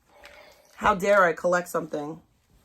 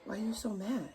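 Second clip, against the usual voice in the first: softer, hushed mock concern; mock worried viewer; 3 semitones higher, 15 dB quieter than usual. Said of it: "They're like".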